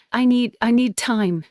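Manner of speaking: ordinary delivery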